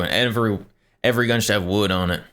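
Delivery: ordinary talk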